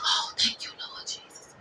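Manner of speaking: breathless whispered thanks to Jesus; whispered relief. Retold or imitated. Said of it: "We're like".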